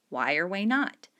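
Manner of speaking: ordinary delivery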